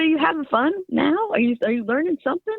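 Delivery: imitating a Southern father checking in; light impression of a Southern dad, cheerful; dad's Southern accent. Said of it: "he had this Southern accent".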